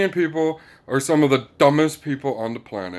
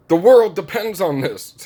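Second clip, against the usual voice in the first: higher, louder mock rant; hammed-up imitation shout; mock yelling Zelensky; 7 semitones higher, 6 dB louder than usual. Zelensky pleading with Americans, mock loud shout. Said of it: "basically yelling at all the American people".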